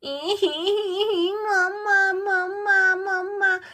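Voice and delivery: put-on drawn-out wailing cry of a small child; crying for mama. Little girl wailing for her mother. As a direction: sob it out, calling for mama.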